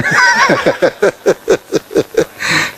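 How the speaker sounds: a big, high burst of real laughter; hearty laugh. Real, not acted. Preacher laughing at his own borrowing story, hearty laugh.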